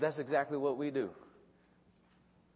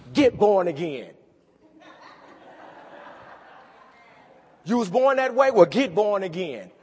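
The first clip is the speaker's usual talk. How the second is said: loud hard-driving preaching; loud fiery sermon climax; preaching at full force; 2 semitones higher, 8 dB louder than usual. Acted. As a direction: hammer the call home to the congregation.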